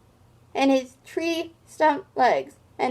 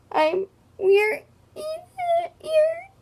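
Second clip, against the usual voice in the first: breaking into a much higher sob; wailing cry; much slower than usual. Acted. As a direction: choke on the word ears. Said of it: "wailed Fredgedy".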